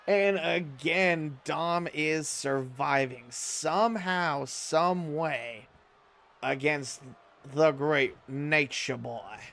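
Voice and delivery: wrestling commentator. Acted. Live wrestling game play-by-play.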